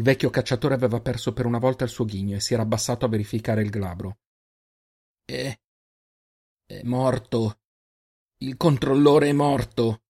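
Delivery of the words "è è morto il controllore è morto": stammer, then let fear rise; growing fear. Acted. Old hunter realizes the controller is dead, growing fear. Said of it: "Nella voce una paura crescente".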